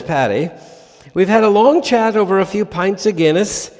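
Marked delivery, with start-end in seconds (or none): 1.1-3.7 put-on a light, higher, louder stab at an Irish accent; hammed-up Irish pub caller declaring war; attempted Irish accent. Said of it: "needs an Irish accent".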